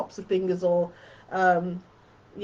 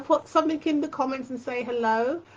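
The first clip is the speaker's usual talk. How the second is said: normal talking voice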